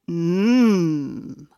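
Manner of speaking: low, sly wolf greeting; a hint of a big bad wolf. A narrator's reading. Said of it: "thought the wolf".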